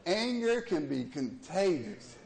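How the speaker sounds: slow emphasis. Real, not acted. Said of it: "sermon point slowed".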